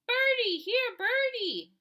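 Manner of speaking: high, loud, slow coaxing call; a little boy luring pigeons; toddler calling birds. A narrator's reading. Toddler Fudge calling the pigeons, high, loud and slow. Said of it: "he called".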